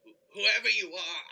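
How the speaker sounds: stammering, loud and scared; frightened stammer. Acted. Scrooge calling out to an unseen intruder, frightened and loud. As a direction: blurt it out in a fright, tripping on the first word.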